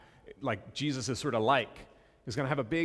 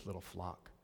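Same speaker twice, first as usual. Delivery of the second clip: tender, hushed; 5 semitones lower, 14 dB quieter than usual. Acted. Scripture read tenderly.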